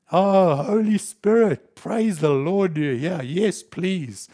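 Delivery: loud, high, slowed praise of relief; pulled from the sand, praising the Holy Spirit loudly and slowly; relieved loud praise. Acted. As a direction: cry out thankfully to the rescuer. Rescued believer crying with relief.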